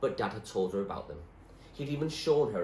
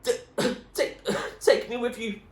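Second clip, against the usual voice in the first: coughing between words, loud and high; spluttering; 7 dB louder than usual. A narrator's reading. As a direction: choke out the plea while swimming. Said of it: "he spluttered".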